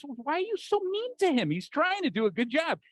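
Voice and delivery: high-pitched indignant inner voice; protective inner voice. Acted. One inner voice scolds another for being mean, high mock indignation. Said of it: "And another voice".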